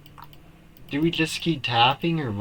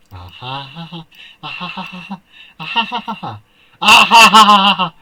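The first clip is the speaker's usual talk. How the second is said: rising hysterical laugh; nervous laughter; 5 semitones higher, 11 dB louder, much faster than usual. Real, not acted. Gamer cracking up in disbelief at the game. Shocked reaction to creepy reveal.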